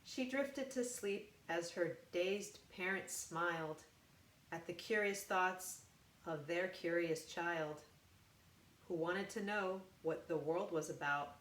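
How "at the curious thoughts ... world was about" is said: ordinary delivery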